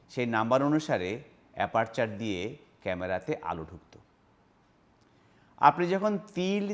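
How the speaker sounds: ordinary talk, nothing marked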